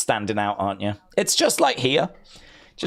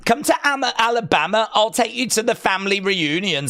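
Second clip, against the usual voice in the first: higher, eager mock invitation; Dead Man Talking; 7 semitones higher than usual. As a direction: pitch it up like a keen suitor inviting you home. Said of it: "said Dead Man Talking".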